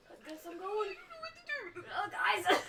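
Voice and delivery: rising to a loud, laughing call of "Oh, guys!"; laughing shout. Real, not acted. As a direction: call out to friends while being hauled away. Player dragged off by the woman.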